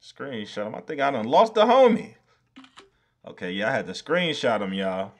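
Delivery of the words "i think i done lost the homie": high, loud cry of loss; loud dismay. Real, not acted. Streamer fearing he lost the lemon, high loud cry. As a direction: cry it out in alarm.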